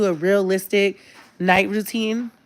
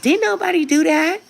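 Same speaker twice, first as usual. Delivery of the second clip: mom's defensive denial; 10 semitones higher than usual. Retold or imitated. Daughter's TikTok, mom denying waking kids, indignant high voice. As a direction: deny it all in a shrill-free high mom voice. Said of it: "the mom was like".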